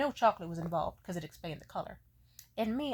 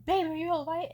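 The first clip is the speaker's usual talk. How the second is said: higher, louder alarmed concern; worried boyfriend; 8 semitones higher, 8 dB louder than usual. Retold or imitated. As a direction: rush to check on her. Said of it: "he was like".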